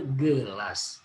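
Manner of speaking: ordinary talk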